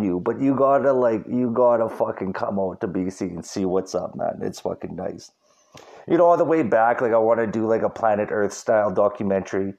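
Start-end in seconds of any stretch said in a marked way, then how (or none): none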